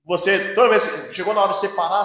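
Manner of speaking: normal talking voice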